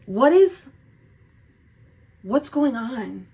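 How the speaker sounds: high, startled exclamation that slows down; loud at first, then drawn-out disbelief; astonished. Real, not acted. Reader reacting to cards that spook her, astonished.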